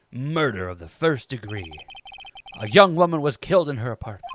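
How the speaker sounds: low, measured inner monologue; hero's narration. Acted. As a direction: introduce the case as the lawyer hero, low and composed.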